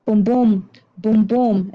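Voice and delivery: steady thudding heartbeat beat; heartbeat rhythm. Shown as a demonstration. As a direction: boom boom chanted like a heartbeat. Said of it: "it's that heartbeat".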